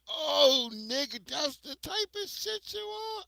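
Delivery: shouting way up high in disbelief; incredulous high-pitched shout; outraged high shout. Retold or imitated. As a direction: yell it up high, offended. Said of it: "I said".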